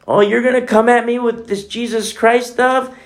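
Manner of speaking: mocking old friend. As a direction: sneer at the faith lightly.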